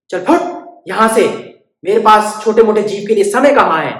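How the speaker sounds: loud, scornful brush-off; rude dismissal. Acted. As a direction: shoo her away with contempt. Barber rudely dismissing bird.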